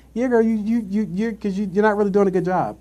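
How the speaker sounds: high teasing put-down; sassy retort. Retold or imitated. Answering the coworker, high sassy retort. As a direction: needle her lightly.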